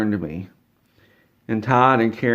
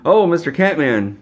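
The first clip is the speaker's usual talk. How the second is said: excited greeting; 6 semitones higher, 6 dB louder than usual. Retold or imitated. Todd greets him brightly.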